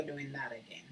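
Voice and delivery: unvoiced tired whisper. Real, not acted. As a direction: breathe it out, worn out. Tired after a bad nap.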